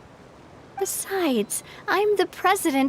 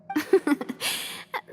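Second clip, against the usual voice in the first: soft embarrassed laugh; 5 dB quieter than usual. Real, not acted. Awkward self-deprecating laugh.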